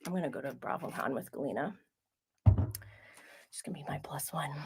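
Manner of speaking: fast, whispered secret; hushed aside. Real, not acted. Host joking about taking Galena as her plus one, whispered aside. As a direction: let it slip out under your breath.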